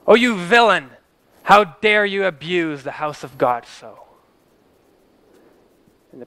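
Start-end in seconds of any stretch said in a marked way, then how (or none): 0.1-4.0 loud, slow furious rebuke, voice raised high; angry outcry. Acted. Doctor Taylor rebuking the intruding priest in church, furious slow shout. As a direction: thunder it at him like a man outraged in his own church. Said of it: "he cries out".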